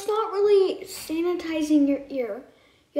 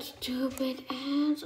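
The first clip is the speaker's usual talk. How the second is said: whispered insult; 7 dB quieter, much slower than usual. Real, not acted. Friend reacting to the piercing.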